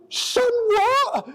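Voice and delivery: high, baffled disbelief; high-pitched sun what, astonished; incredulous. Retold or imitated. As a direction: squeak it out, totally thrown. Sunburnt rider hears of sunscreen.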